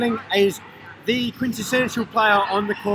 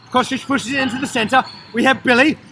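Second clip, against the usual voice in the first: high, loud running commentary; excited play-by-play; 5 semitones higher, 4 dB louder than usual. Real, not acted. Commentator calling Billy's fancy shot, excited play-by-play. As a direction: call the attack as it builds. Live call of an attack.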